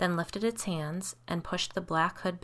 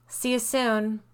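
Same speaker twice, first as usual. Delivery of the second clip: higher, lilting farewell; calling after them; 5 semitones higher, 4 dB louder, slower than usual. A narrator's reading. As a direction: call it out as they hurry away. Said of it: "Kate called".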